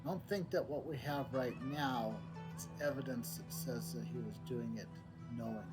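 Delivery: ordinary delivery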